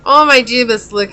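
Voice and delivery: flustered rush, pitch jumping up. Real, not acted. Breathless flustered reaction.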